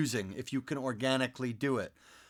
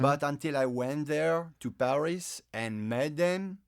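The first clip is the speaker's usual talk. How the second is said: measured French-accented English. Shown as a demonstration. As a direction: explain it as a Frenchman would. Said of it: "doing the French accent".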